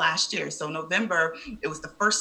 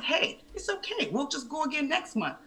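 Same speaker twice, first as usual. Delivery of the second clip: higher, softer imitation of the calm husband; 10 semitones higher, 4 dB quieter than usual. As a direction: soothe her like an easygoing husband, lightly mimicked. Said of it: "he's so calm, cool, and collected".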